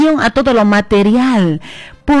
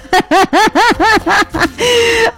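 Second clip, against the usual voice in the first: long, high peal of laughter; real laughter; loud laugh; 11 semitones higher than usual. Real, not acted. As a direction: burst out laughing. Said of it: "Van a disculpar que yo me ría así".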